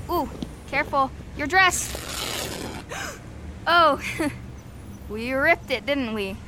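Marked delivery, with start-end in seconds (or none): 2.0-3.7 sharp gasp. Acted. Dress rips while helping up. 4.1-5.1 embarrassed giggle. Acted. Laughing after ripping the dress.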